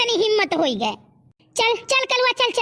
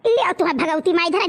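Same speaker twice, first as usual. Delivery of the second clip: normal talking voice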